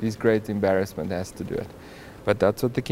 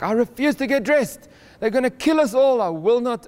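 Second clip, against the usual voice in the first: high, louder stubborn refusal; defiant Mordecai; 10 semitones higher, 4 dB louder than usual. Retold or imitated. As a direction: dig in your heels, refusing loudly.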